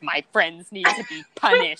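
put-on slow, lightly creepy child voice; deadpan a spooky little girl; Lilo impression. Fan quoting Lilo's eerie line to her co-host. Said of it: "she's like".

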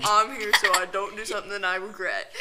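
deep cool voice. Acted. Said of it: "make your voice deep".

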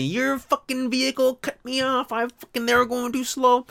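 high, whiny angry complaint; hammed-up furious complaint; mock complaint. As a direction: whine it high at the company. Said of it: "complain to the company".

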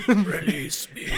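laughing ghost voice; overacted death scene. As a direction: wail it out half laughing. Voicing the dead horse.

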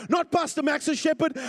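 loud, high preacher's cry; full-force preaching. Real, not acted. Preacher telling congregation the shepherd is theirs, full-force preaching. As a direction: throw it out hard to the congregation.

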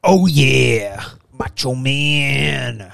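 slow, drawn-out wrestler growl; Macho Man impression. Host tries a wrestler impression, slow drawn-out. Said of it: "That's not a good Macho Man".

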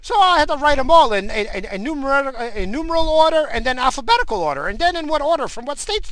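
high, excited mock voice of an oblivious coworker; mocking coworker impression. Mimicking a coworker obsessed with presidents, mocking high voice. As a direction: rattle on in a high-pitched dopey imitation. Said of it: "He's like".

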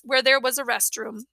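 usual speaking voice

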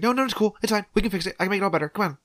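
high, rapid-fire reassurance; retold manic mother. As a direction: imitate frantic, too-eager soothing. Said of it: "a weird state".

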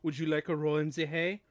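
put-on lightly done German accent; hint of a cute German accent. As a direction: play it sweetly with a touch of German. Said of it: "with that cute German accent".

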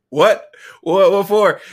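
high, loud, caught off guard; startled outburst. Retold or imitated. Caught off guard by the inner voice, a loud startled cry.

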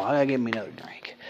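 quick breathy whisper; whispered aside. Real, not acted. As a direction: mutter it under your breath, fast. Quick aside about needing a drink.

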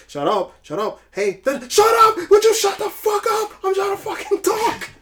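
building from snapping to a very high, loud scream; Sonny shouting his wife down on the phone; imitating Sonny yelling. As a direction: lose it on the phone, rising to a roar. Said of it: "And he's like".

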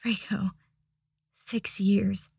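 hushed, breathless whisper; tearful whisper. Acted. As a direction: whisper it, overwhelmed. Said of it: "Harry croaked".

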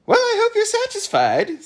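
very high, loud character voice; piercingly high contrarian; high-voiced know-it-all. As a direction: pipe it up loud, lecturing with confidence.

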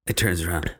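usual speaking voice

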